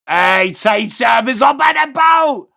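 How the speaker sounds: loud, slow call over the music; excited shout. Real, not acted. Streamer shouting a greeting to a viewer, loud and drawn out. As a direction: hail him across the room, drawn out.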